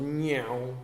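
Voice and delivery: a vocal "meow" that bends like a passing car; whizzing-past sound effect. Shown as a demonstration. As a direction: mimic something zooming by with a quick pitch bend. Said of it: "the idea of something whizzing past you".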